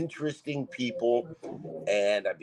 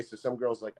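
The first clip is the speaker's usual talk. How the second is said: usual speaking voice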